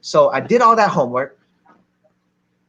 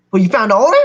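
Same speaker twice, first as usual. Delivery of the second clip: loud, rising incredulous question; surprised question; 6 semitones higher, 6 dB louder, much faster than usual. Retold or imitated. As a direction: act out an uncle suddenly taking notice. Uncle startled by the news.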